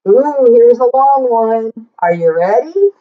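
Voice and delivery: usual speaking voice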